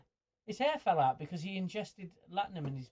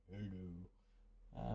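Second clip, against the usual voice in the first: much lower, softer, croaky-cold "Hello."; deep voice; 7 semitones lower, 12 dB quieter than usual. Shown as a demonstration. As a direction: drop low as if with a chest infection. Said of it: "I can go deep when I've got".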